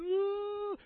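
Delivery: high sustained mock wail; imitated wail. Pastor imitating Leah crying at the car, mock wailing. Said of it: "she's just crying".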